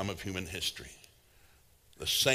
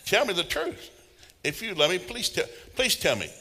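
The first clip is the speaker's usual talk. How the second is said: higher, begging voice; higher pleading; mock pleading; 8 semitones higher than usual. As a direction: plead like you need to know. Pleading for the truth.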